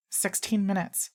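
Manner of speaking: put-on small girl stating a fact. A narrator's reading. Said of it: "says Maya Lee".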